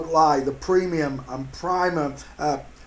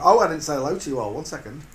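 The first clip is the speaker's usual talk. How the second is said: normal talking voice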